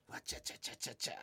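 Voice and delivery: whispered silly gibberish; teen chatter. Retold or imitated. Teens babbling nonsense, whispered mock chatter. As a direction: mimic the boys' giggly nonsense under your breath. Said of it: "言って".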